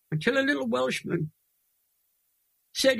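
fighting back real tears as he speaks; choked up with tears. Real, not acted. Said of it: "I'm gonna cry now".

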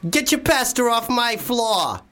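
put-on high, drawn-out Italian-American New York accent; indignant put-on Italian-American accent, slow and high; aggressive Italian-American. Podcaster plays aggressive New Yorker over spilt pasta, Italian-American accent. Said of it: "He's an Italian-American gentleman, and he's aggressive".

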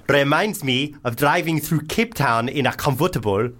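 put-on South African accent, slightly slipping; wobbly South African accent. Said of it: "Ah, lost it a bit there".

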